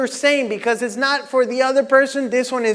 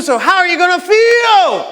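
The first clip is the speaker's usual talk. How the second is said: higher, louder hype shout, pushing harder; rallying shout; 7 semitones higher, 5 dB louder than usual. Real, not acted. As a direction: demand an answer from the crowd. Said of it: "say it with everything that you got".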